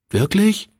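put-on high, astonished child's question; sudden disbelief, high; boy can't believe it; surprised. Child surprised by pastor's wish.

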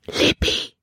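urgent whispered name. Acted. Caleb finding Libby below deck: urgent whisper. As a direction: hiss her name so nobody hears. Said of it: "Calebs Stimme war ein Flüstern".

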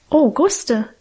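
a mild, lightly voiced surprise; surprised. Minchen finds her sister in the kitchen, mildly surprised. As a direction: caught off guard, half pleased. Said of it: "sagte auch sie erstaunt".

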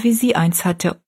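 usual speaking voice

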